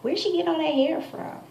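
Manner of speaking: high, prying question; nosy neighbor. Retold or imitated. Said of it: "she comes back".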